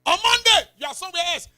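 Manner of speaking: high, loud mocking cry; a shouted jab at fickle faith; sudden loud call. Preacher mocks fickle churchgoer.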